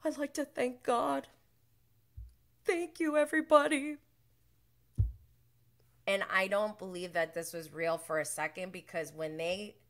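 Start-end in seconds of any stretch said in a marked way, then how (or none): 0.0-4.0 high, gushing fake acceptance speech; sarcastic award-winner impression; mock award speech. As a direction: ham up thanking God like at the Oscars. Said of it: "accepting an award".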